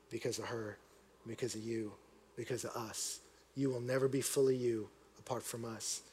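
low, soft, heartfelt delivery; hushed, measured reflection; tender sincerity. Real, not acted. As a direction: speak gently and earnestly from the heart. Tender close of a moving story.

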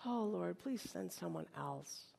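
very soft, low, slow prayer; hushed plea. Acted. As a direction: beg quietly, almost under your breath. Voices Moses's plea to God.

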